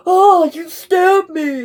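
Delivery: slow, high, loud hammed-up whine; mock whine of the boy. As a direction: stretch out the boy's overdone complaint. Mimicking boy's exaggerated complaint.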